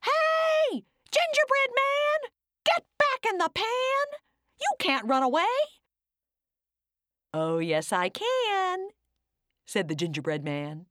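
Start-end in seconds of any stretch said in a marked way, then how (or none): 0.0-5.5 high calling shout. Acted. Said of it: "calling out". 7.3-8.9 gingerbread man voice. Acted.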